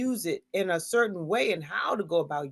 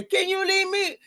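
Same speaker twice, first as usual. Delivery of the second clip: harsh; 11 semitones higher than usual. Retold or imitated. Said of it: "you gotta be all harsh".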